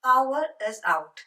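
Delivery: drawn out word by word; slow, clear model phrase; slow teaching demo. Shown as a demonstration. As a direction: say it slowly so it sticks. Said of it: "ഏറ്റവും common ആയി നമ്മൾ കേൾക്കണ രീതി".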